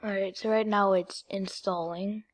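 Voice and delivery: ordinary delivery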